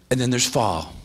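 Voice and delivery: ordinary talk, nothing marked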